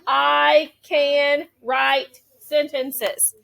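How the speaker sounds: very slow, stretched words. Shown as a demonstration. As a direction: read as if pointing at each word. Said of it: "point to my words as I say them".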